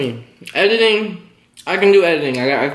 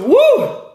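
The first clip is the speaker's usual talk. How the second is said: coughing fit; 4 dB louder than usual. Real, not acted. Coughing fit while eating.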